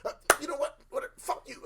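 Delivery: high-pitched mock indignation; imitated angry outburst. Retelling her angry parting outburst.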